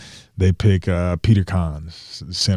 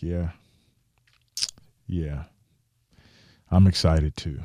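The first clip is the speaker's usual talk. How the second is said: dull unexcited answer. Retold or imitated. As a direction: say you're excited without meaning it. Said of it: "Didn't sound very excited".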